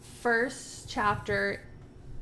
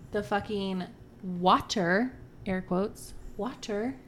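ordinary delivery

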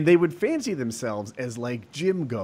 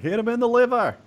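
put-on higher, louder Boston accent yell; Boston accent shout; 11 semitones higher, 4 dB louder than usual. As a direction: bark it like a Boston fan, Boston accent. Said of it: "I think that's my Boston accent".

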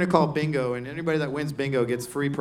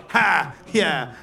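gleeful gloating; 3 semitones higher, 5 dB louder than usual. Retold or imitated. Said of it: "And we're both like".

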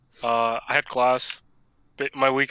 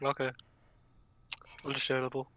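usual speaking voice

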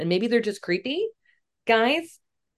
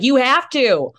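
insistent mom; 5 semitones higher, 6 dB louder, slower than usual. Retold or imitated.

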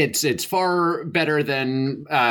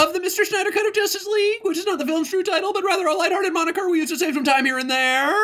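high, fast presenter read; announcer voice; faster than usual. Pretend. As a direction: rattle it off like a host. Formal announcer intro.

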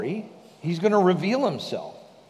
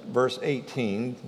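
ordinary talk

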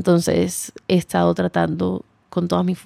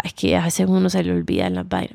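usual speaking voice

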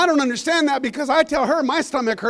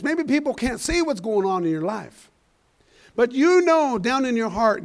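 ordinary talk

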